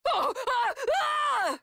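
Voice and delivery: high sudden cry of effort; sharp yelp. Acted. Combat character struck, sharp high yelp. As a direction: yelp as if hit in a fight.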